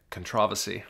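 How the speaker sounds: British pronunciation, stress on the second syllable of controversy; British accent. Retold or imitated. Mimicking how friends in England say controversy. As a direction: say controversy the British way, con-TROV-ersy. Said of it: "as our friends in England say".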